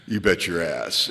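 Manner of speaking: a hint of a low, slow, emphatic yes; eager yes. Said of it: "your answer sounds a little like this".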